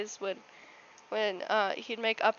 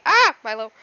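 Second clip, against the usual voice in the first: loud sudden shout at the dog; startled call; 4 semitones higher, 12 dB louder than usual. Real, not acted. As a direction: blurt the dog's name out loud.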